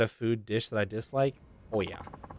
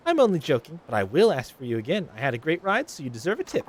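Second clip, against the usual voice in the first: higher, gushing flirt; flirty passenger; 5 semitones higher, 4 dB louder than usual. Acted. Flirty passenger gushing at taxi driver Takun, higher voice. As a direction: coo at the driver like you're smitten.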